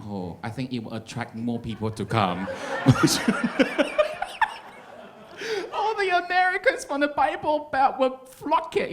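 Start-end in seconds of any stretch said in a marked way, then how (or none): 2.5-5.7 high, loud shriek of laughter. Real, not acted. Comic shrieking with laughter at the crowd. 5.7-8.9 high, loud words squeezed out laughing; squealed through laughter. Real, not acted. Comic laughing through the Bible Belt joke.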